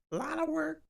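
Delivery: A lot of work said in a high squeaky voice; goofy falsetto on A lot of work. Shown as a demonstration. Repeats phrase in silly voice.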